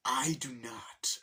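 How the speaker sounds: slow, menacing whisper; whispered refusal. Retold or imitated. Bowser refusing to yield, slow whispered menace.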